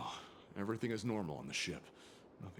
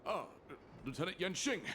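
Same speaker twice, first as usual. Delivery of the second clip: startled greeting; 10 semitones higher than usual. Acted. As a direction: caught off guard, pitch jumps up. Guard startled by officer's arrival.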